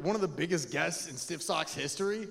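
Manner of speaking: loud, high crowd hype-up; hype announcer. Pretend. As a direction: whip the crowd up shouting. Hypes up crowd for guest.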